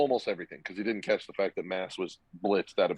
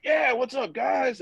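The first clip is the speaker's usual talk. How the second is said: much higher, louder, hammed-up cheery hello; over-eager greeting; 13 semitones higher, 4 dB louder, slower than usual. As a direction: play the drunk guy waving too happily to the crowd. Hungover teammate greets crowd loudly.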